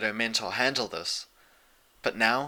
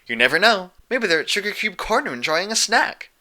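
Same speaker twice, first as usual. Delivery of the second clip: much higher, louder cheerful chatter; bubbly party pony; 9 semitones higher, 5 dB louder than usual. A narrator's reading. Pinkie cheerfully suggesting a search spot, high and bubbly. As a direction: bounce through it happily.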